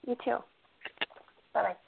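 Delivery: ordinary talk, nothing marked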